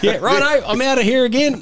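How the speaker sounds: high-pitched retold reply. Photographer backing out of the trucker's challenge, high mock reply. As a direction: play a hasty exit, pitched right up.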